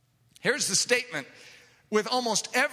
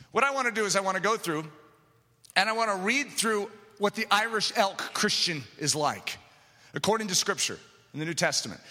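ordinary talk

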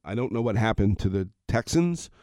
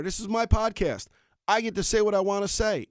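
ordinary delivery

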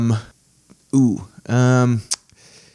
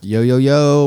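hype greeting; 3 semitones higher, 5 dB louder, slower than usual. Real, not acted.

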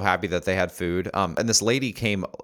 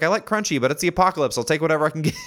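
pitched-up, resigned, take-what-you-can-get voice; higher mock grateful guy; 8 semitones higher, 3 dB louder, faster than usual. Retelling the peanut butter guy's answer in a higher voice. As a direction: play the man settling for any peanut butter. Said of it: "He was like".

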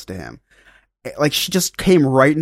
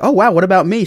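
eager question; 7 semitones higher, 6 dB louder, faster than usual. Retold or imitated. Eagerly asking for his own fortune.

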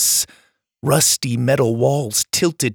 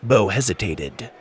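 ordinary delivery, nothing marked

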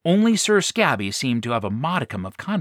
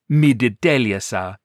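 put-on Pharaoh's accent; 5 dB louder than usual. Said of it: "or in his case".

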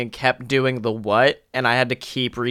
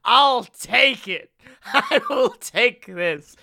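slow, high, drawn-out declaration; theatrical resolve; 8 semitones higher, slower than usual. Retold or imitated. Desperate student accepting a risky stage role, theatrical resolve.

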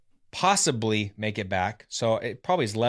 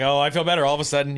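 quick, higher-pitched fake eagerness; hurried mock-hopeful backtracking; mock sudden recovery; 4 semitones higher, 4 dB louder, faster than usual. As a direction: rush it out as if miraculously cured.

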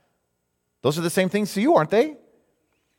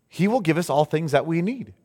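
ordinary talk

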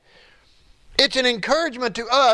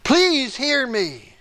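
slow, earnest plea; pleading prayer; 2 semitones higher, much slower than usual. Retold or imitated. David crying out to God, slow pleading. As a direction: beg to be heard, drawn out. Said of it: "David is crying out to God".

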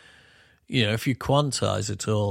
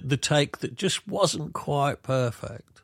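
ordinary delivery

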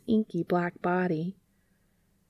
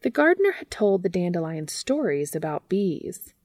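ordinary delivery, nothing marked

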